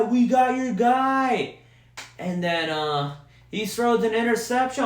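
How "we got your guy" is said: high, slow, yelled gloat; a mock rival fan crowing at full voice; shouted rival-fan taunt. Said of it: "all you Vikings fans were talking shit".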